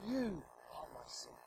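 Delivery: soft intimate whisper; tender hushed whisper. Shown as a demonstration. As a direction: whisper it close to the listener. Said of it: "he wants to say to you".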